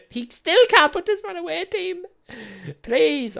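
high loud exasperated plea. Real, not acted. Gamer yelling at his team, exasperated plea. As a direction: cry out at the team. Frustrated at missed chances.

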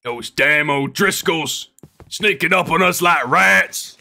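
loud, high, worked-up outlaw impression; hammed-up outlaw voice, pushed loud; Arthur Morgan. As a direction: holler to your gang leader like a game outlaw. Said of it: "Arthur Morgan impression".

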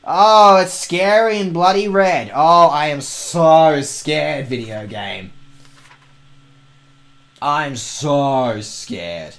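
slow, drawn-out mock fear; sarcastic. Real, not acted. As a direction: sarcastically pretend to be scared. Sarcastically mocking the game.

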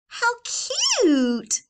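very high, loud squeal; gushing girl. Acted. Biggest girl, high gushing squeal. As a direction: swoon over it. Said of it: "said the biggest girl".

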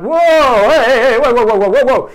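loud, high, frantic whoa whoa to stop their son; panicked parents holding him back, loud and high; loud alarmed cries of whoa; alarmed parents shouting. Retold or imitated. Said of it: "That mom and dad were like".

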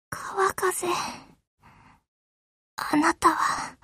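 quiet sinking farewell; dying farewell. Acted. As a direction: whisper her last words to her sister.

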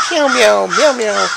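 high squeaky cat meows; imitating meows. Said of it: "both here on the steps going".

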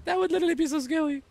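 high and fast mock fright; mock squeal. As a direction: squeak it out in pretend fear.

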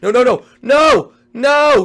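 high, loud NO shouts; shouting no as he's caught; panicked shout. Real, not acted. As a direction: yell it in panic. Being caught in race.

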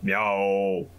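low, drawn-out meow; cat meow. Acted. Cat answers the player's greeting.

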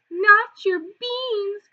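high, loud, slow outraged cry; drawn-out outraged protest. Acted. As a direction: protest in shock. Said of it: "cried Scipio, outraged".

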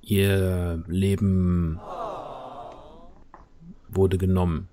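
very slow, low raven voice; deep growling voice. Acted. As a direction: drag each word out like an old omen. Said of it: "eine tiefe, grollende Stimme".

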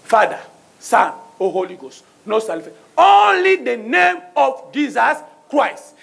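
ordinary talk, nothing marked

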